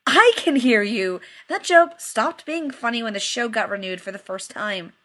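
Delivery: sharp annoyed retort that the joke is stale; snapped. Acted. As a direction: bite off the line in annoyance. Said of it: "and snapped".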